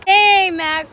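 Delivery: loud call up high. Real, not acted. Calling out to Mac by the pool, loud. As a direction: call across to him, loud.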